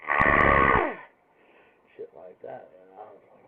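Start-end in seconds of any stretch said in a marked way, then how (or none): 0.1-0.9 a loud, low mock cry heard through the door; short startled loud shout; imitated scream. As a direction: blurt out a sudden loud yelp. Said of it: "I heard".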